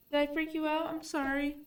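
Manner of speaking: high pet-talk apology to the cat. Real, not acted. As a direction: coo a sorry to a startled cat.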